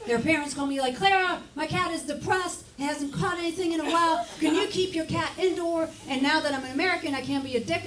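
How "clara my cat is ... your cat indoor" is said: much higher, pleading neighbor voice; whiny cat parent. Retold or imitated. Cat owner phoning Clara, high and pleading. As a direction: plead like a fussy pet owner.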